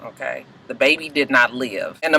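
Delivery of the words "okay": drops to a soft, hushed confession to her mother; quiet confession. Retold or imitated. Daughter confessing to her mother, hushed and broken. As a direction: let it fall away, barely there.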